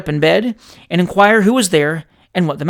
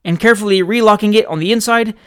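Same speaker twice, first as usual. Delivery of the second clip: ordinary delivery, nothing marked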